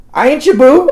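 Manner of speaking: indignant retort, imitated high and loud; hammed-up comeback with a laugh in it; Michelle. Boyfriend imitating girlfriend's reaction to "boo", mock indignation. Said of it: "Michelle's reaction is".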